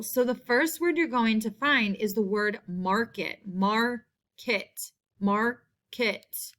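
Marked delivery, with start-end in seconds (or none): 3.5-6.5 "mar... ket", a clear gap between syllables. Shown as a demonstration. Teacher sounding out a spelling word, "Market" split in two syllables. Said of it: "in the first syllable".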